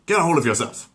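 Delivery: high, loud gruff order; Peter barking. Acted. Peter trying to calm Brian, loud and gruff. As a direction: snap him out of it.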